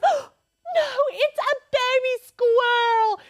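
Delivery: high, loud, slow mock wail; hammed-up tearful Becca. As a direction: wail it like someone about to cry. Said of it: "Starts freaking out crying".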